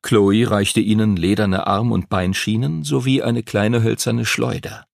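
plain reading voice

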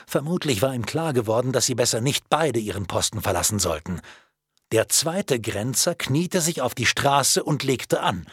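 plain reading voice

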